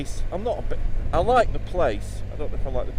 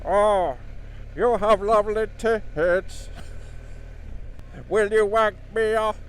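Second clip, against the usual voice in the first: [name] impression; 6 semitones higher, slower than usual. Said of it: "[name] in sexual situations".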